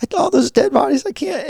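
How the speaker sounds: put-on a scared boy who can't finish; high distressed child voice fading out; upset kid. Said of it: "he's like".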